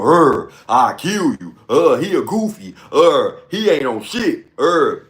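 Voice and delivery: slow, growly fake-hard threat; mock tough guy. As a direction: play a wannabe gangster acting hard. Mocking guys who act tough.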